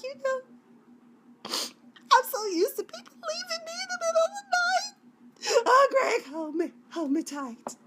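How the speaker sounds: very high, hammed-up tearful whine; fake-sob it like an overwrought heroine; dramatic mock crying. Lover begging Greg to hold her, melodramatic mock crying. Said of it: "I'm just being really dramatic".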